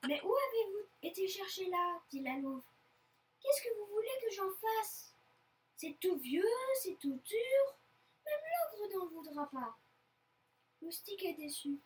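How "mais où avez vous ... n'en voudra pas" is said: high, loud, exasperated complaint; exasperated mother's raised voice; scolding. Acted. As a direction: scold them for what they brought home. Mother wolf scolds over the catch.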